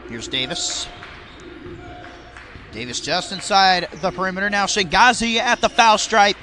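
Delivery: brisk live sports call; play-by-play commentary. Acted. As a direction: call the game as it happens.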